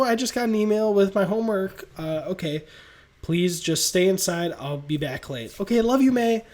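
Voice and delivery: quick back-and-forth, lightly voiced; rapid fire dialogue. Peter and Aunt May trade quick lines, rapid. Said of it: "this is all rapid fire dialogue".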